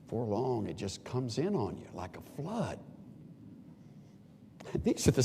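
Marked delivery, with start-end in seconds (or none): none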